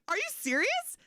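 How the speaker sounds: high, disbelieving squeak; high shocked are you serious; incredulous. Retold or imitated. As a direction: play it as stunned disbelief. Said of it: "And I was like".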